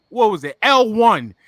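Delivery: ordinary talk, nothing marked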